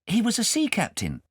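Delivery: high, lightly voiced boy; young boy. Kay answering his guardian, a high boy's voice. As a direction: answer shyly as a small boy.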